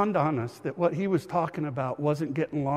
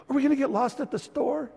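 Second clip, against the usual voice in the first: put-on scared little boy; 9 semitones higher than usual. Scared toddler asking dad.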